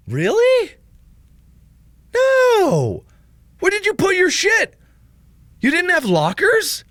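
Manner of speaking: very high, squeaky disbelief; incredulous. Real, not acted. Streamer hearing viewer never had lockers, incredulous squeal. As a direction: shoot way up in pitch, astonished.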